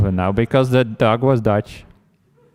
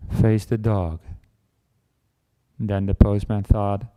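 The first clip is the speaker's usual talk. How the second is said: ordinary talk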